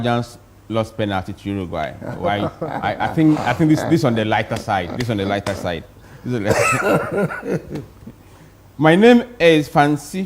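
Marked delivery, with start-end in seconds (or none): 6.2-10.2 laughing while reading. Real, not acted. Host laughing while reading text message.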